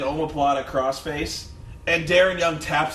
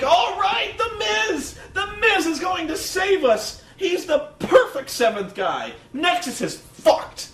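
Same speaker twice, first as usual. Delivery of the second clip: high, loud mock shout of elation; hammed-up announcer hailing a saviour; excited commentator; 3 dB louder, slower than usual. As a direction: imitate an overjoyed commentator calling out. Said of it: "Cole was hilarious in this match".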